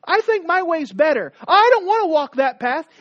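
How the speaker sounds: high, loud insistence; stubborn defiance. Retold or imitated. People rejecting God's path, loud and high. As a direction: declare your own way loudly. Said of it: "Us that said".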